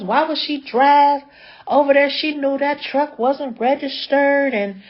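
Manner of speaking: a hint of a fed-up sister venting; going off. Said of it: "this lady started going ham".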